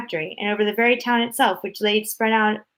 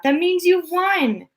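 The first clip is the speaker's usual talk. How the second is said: high, slow, thrilled announcement; excited cry; 10 semitones higher, slower than usual. A narrator's reading. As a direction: stretch out the joyful news. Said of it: "he cried out".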